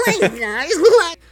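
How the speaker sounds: very high, loud squeak; tiny chipmunk voice. Acted. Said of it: "I sound like a tiny little".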